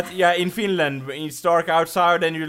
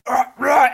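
loud, high hammed-up groan of pain; mock pain gasp; 8 semitones higher, 7 dB louder than usual. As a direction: fake a stabbed man's cries. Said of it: "and you're like".

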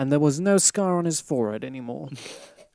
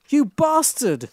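higher, slow hurled insult; angry shout; 10 semitones higher, 4 dB louder, slower than usual. A narrator's reading. Goth girl shouts at Draco after spotting the tattoo, furious. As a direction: shout it at the lying lover. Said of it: "I shouted angrily".

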